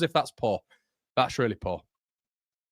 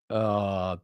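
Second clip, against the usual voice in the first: ordinary delivery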